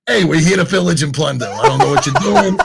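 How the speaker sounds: put-on Viking raider voice, laughing through it. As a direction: boast like a raider, half laughing. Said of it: "you're dressed up in a Viking outfit".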